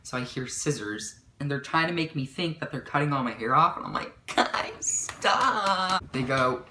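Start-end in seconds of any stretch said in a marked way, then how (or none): 4.3-6.0 drawn-out, high-pitched "stop"; acted pleading. To friends pretending to cut her hair, acted drawn-out plea. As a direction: imitate a helpless plea, stretching the words.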